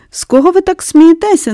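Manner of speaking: put-on girl sullenly questioning the laughing nurse; sulky question. A narrator's reading. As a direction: ask it sullenly. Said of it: "насуплено спитала Мері".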